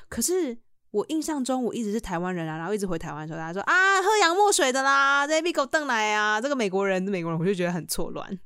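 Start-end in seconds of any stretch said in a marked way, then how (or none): 3.7-7.4 high, loud jeer that settles down by the end; mock teasing. Relatives back home teasing her as a foreigner, loud mock jeer. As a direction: jeer at the returnee.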